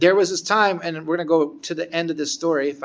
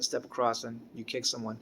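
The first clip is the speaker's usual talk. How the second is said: usual speaking voice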